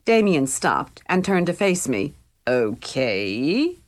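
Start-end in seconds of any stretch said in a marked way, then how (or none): 2.5-3.8 dragged out. A narrator's reading. Damien stalling his friend, a long drawn-out okay. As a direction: draw the word out, doubtful. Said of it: "He dragged the word out".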